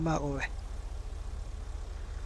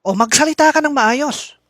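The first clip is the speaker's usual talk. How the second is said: loud, high, slow reprimand; furious mayor dressing down an underling; angry scolding; 9 semitones higher, 11 dB louder than usual. Acted. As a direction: lay down the law at full volume. Mayor orders him to speak properly.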